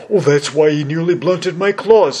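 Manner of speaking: high, loud voice of sudden realization; cowardly lion. Acted. Lion learns the woodman is tin, loud amazed lion voice.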